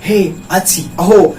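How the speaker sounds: a demo cry of wonder; mild exclaimed surprise; mock surprise. Shown as a demonstration. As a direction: exclaim हे, अच्छी, अहो in surprise. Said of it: "जैसे हम लोग बोलते हैं".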